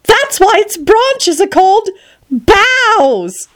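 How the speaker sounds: very high, squeaky little flower; crying-out daisy. Acted. Daisy explaining its pun, high squeal. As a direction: squeal the punchline, drawn out. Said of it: "cried a daisy".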